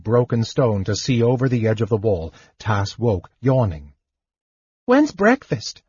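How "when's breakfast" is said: high, chirpy little kender voice; bright and carefree, much higher; cheerful kender. A narrator's reading. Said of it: "the Kender inquired cheerfully".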